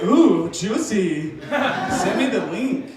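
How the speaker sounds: slow, savoring voice of a true crime fan; eager true crime fan. Retold or imitated. A girl offered a murder podcast, savoring and slow. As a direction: relish every word. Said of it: "she's like".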